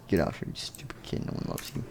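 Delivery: very soft, quick, low retelling; hushed insult. Acted. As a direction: rattle it off under your breath. Retells the insult under breath.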